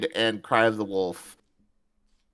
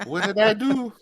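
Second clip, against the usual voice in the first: higher-pitched, hammed-up bad French accent; 11 semitones higher than usual. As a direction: squeak it in a fake French accent. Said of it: "That was my really shitty French accent".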